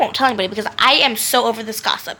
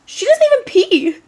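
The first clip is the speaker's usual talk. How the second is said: shocked; 6 semitones higher than usual. Retold or imitated. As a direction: gasp out the disbelief, going higher. Shocked reaction to gossip.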